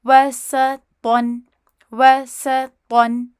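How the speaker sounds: وسطن read slowly and clearly as wasatan, each syllable drawn out; slow teacher demo of وسطن with short vowels; model reading. Shown as a demonstration. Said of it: "وسطن یوں نہیں کرنا".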